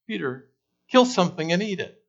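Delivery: high, loud commanding voice from heaven; booming voice of God. Retold or imitated. Preacher voicing God commanding Peter, loud and high. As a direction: call down the order as God.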